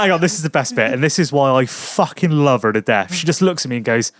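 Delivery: normal talking voice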